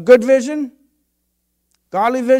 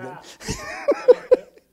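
high, slow laughing words. Real, not acted. Preacher recalling his kids, laughing high. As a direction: laugh through it fondly.

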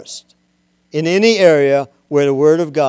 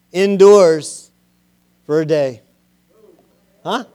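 ordinary talk

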